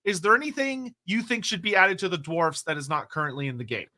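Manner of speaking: normal talking voice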